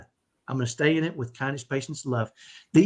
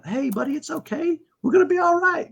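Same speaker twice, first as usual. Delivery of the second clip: put-on high, sing-song soothing of a child; acted-out parent comforting, climbing higher; loving reassurance; 11 semitones higher than usual. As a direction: coax a little boy kindly, voice lifted. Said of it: "in a spirit of love".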